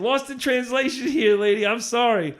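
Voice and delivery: pleading she never said it; exasperated explaining. Retold or imitated.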